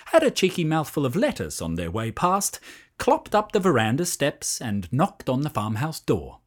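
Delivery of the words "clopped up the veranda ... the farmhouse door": ordinary delivery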